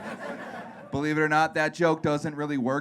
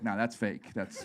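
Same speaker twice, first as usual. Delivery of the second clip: mock dismissive parents; 7 semitones lower, 10 dB quieter than usual. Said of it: "They're like".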